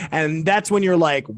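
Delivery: usual speaking voice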